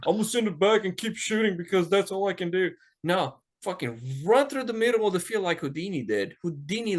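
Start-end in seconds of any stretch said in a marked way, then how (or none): 0.1-2.7 mock whiny teammate. Mocking a serious teammate's attitude.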